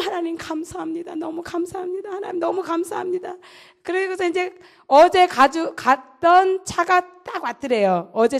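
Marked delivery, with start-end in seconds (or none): 0.0-3.3 higher, softer thanks, near tears; grateful prayer. Retold or imitated. Relieved private thanks God softly, near tears.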